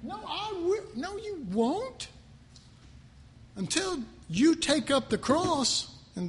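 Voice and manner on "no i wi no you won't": higher, quick retort then slowed rebuttal; mock argument. Pastor voicing God cutting off a protester, slowed. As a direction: snap back no you won't, drawn out. Imagined back-and-forth with God.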